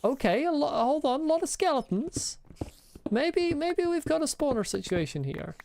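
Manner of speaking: high tense rush of alarm; panicked. Real, not acted. Gamer panics at a skeleton swarm in the cave. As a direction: keep the pitch high and worried.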